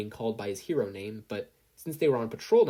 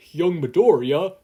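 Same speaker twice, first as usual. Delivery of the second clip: shouted, slow and much louder; calling out loud; 6 semitones higher, 12 dB louder, slower than usual. Acted. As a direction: call out across the beach in alarm. Searching after explosion.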